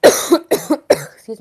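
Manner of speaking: throat catching, coughing. Real, not acted. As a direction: cough then a rough excuse me. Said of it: "something caught my throat".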